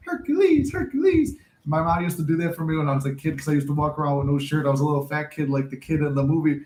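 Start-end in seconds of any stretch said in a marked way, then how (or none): 0.1-1.3 high sing-song call of Hercules; mom calling Hercules. Retold or imitated. Mom in The Nutty Professor calling her son, high call. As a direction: call Hercules like a mother shouting for her kid. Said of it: "the mom was like".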